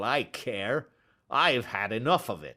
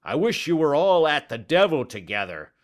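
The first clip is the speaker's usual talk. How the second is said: furious, much higher and louder; angry shouting; 6 semitones higher, 5 dB louder than usual. Acted. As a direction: bellow at the pestering little man. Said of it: "he shouted".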